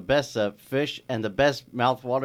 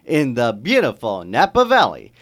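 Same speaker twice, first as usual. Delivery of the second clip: announcer; 6 semitones higher, 9 dB louder, slower than usual. Acted. Host introduction.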